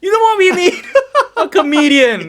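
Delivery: very high, loud hammed-up proclamation; loud comic announcement breaking into laughter; mock eureka. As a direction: pitch it way up as a bright idea, laughing. Acting out a country wanting comedians.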